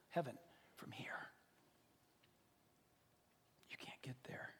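very soft, near whisper; hushed emphasis. Real, not acted. Preacher telling congregation they can't reach heaven, whispered. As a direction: drop to a whisper for the key point. Grave point dropped to a whisper.

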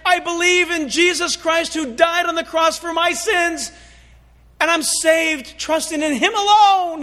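high, loud, earnest plea; heartfelt confession. Acted. Sinner at heaven's door confessing faith, loud and earnest. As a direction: pour out the confession sincerely.

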